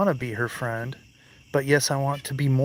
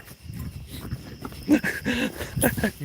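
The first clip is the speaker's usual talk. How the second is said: high breathy laughter; 12 semitones higher than usual. Real, not acted. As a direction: laugh in disbelief. Laughing after lightning.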